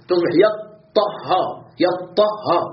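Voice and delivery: "يطهر" stretched slowly, with the ط and ه held doubled; doubled letters shown. Shown as a demonstration. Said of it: "هكذا شددت الطاء والهاء". ("يطهر" ببطء مع تشديد الطاء والهاء)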